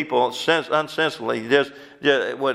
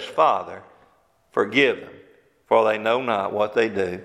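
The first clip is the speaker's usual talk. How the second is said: tender plea; 5 semitones lower than usual. Retold or imitated. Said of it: "Jesus says".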